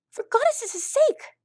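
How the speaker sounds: very high, frustrated outburst; exasperated colt. A narrator's reading. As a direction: throw up your hands as a desperate kid.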